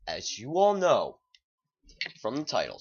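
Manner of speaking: ALL swooping up high and loud; dramatic announcement. Acted. Vlogger restarting his trailer intro, dramatic announcement. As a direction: punch ALL, stretched and loud, then fall away.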